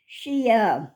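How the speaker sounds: ordinary delivery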